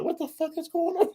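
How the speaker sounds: mock-weepy squeak, high-pitched and bewildered; retold tearful disbelief. Co-host recalls tearing up at a show, tearful squeak. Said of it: "I feel myself tearing up".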